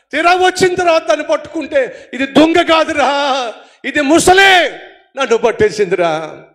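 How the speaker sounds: loud, slowing, desperate cry; panicked shout. Acted. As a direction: scream that the crocodile caught him. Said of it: "అరుస్తున్నాడండి". (మొసలి పట్టిన భయంతో అరుపు)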